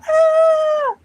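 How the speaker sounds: high, loud shocked scream; imitated startled scream. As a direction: shriek like a girl seeing something awful. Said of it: "all of a sudden she sees something and goes".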